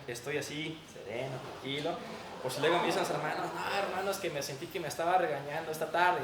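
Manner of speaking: normal talking voice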